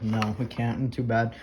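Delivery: low, quiet refusal, playing an officer; lightly voiced unhelpful cop; dismissive police officer. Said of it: "the police officer's like".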